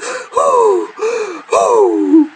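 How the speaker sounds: high gasping for air; breathless gasping. Retold or imitated. Girlfriend gasping for air from tickling. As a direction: gasp like you can't breathe. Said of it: "she can't breathe".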